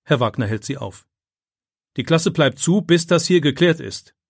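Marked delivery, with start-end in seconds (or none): 2.0-4.2 loud, firm command; teacher stopping the boys at the door, stern and loud; stern order. Acted. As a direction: block them with authority. Teacher stops classmates.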